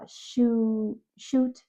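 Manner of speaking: "shoe" said with no final t, then "shoot" with the t; final sound dropped. Shown as a demonstration. Therapist contrasting child's "shoe" with "shoot" slowly. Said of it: "they say shoe".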